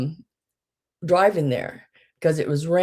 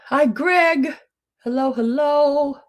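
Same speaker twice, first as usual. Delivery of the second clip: cheery greeting; 10 semitones higher, 4 dB louder, slower than usual. Real, not acted. Greeting viewer.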